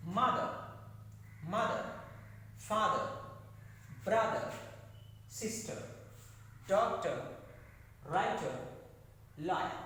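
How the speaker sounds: MOTHER, FATHER, BROTHER, SISTER, DOCTOR, WRITER, LAWYER punched on the first syllable; stressed first syllables. Shown as a demonstration. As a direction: drill Mother to lawyer one by one, force up front. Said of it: "pronounce all these seven words with stress".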